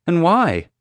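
high, loud demand. A narrator's reading. Upset mom questioning the guard about the move, demanding. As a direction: fire the question at them. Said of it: "she demanded".

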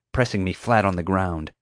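plain reading voice

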